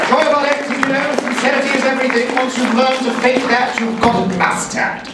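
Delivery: badly faked English accent. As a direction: put on a terrible, high English voice. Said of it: "the worst English accent I've ever done".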